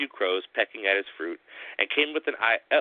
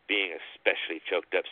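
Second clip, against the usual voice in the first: plain reading voice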